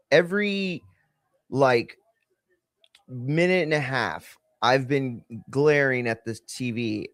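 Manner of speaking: ordinary delivery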